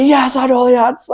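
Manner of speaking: recalling cheering at the million question, high and gleeful; mock cheer. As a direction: cheer like you nailed the final question. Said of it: "cheering and stuff at the end".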